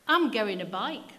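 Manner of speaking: loud, broad Yorkshire lad; a little Yorkshire lad announcing his present; boy. Acted. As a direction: blurt it out like an excited small boy. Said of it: "the boy told him".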